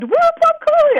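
high, loud cheering whoop; fan scream. Retold or imitated. Concert fan in Las Vegas cheering her hometown, high loud whoop. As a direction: yell it out like a fan in the crowd. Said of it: "someone screamed".

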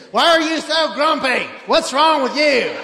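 hammed-up scolding mother; yelling mom. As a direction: nag the grumpy kids at two a.m. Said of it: "just yelling at the kids".

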